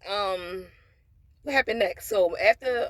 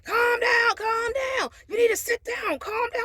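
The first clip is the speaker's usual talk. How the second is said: imitating screaming mother-in-law; 14 semitones higher, slower than usual. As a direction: mimic her screaming at the bride, pitched way up. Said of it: "she starts screaming".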